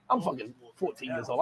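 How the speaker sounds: ordinary talk, nothing marked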